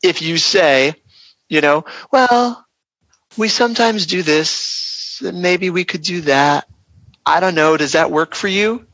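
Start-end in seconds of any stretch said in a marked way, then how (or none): 2.1-8.8 high, slow, wavering hedge; hammed-up nervous backpedaling; mock insecure agent dodging the price; hesitant, unsure. Shown as a demonstration. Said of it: "And I'm exaggerating".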